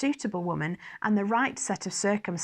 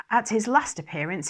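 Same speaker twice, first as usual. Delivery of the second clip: ordinary delivery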